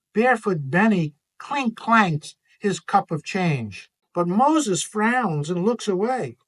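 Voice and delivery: ordinary delivery, nothing marked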